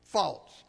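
faults punched out loud and falling; stress on faults. Shown as a demonstration. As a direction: lean hard on the word faults. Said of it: "Let me put the emphasis on your".

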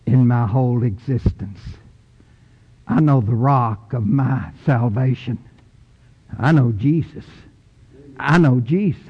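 ordinary talk

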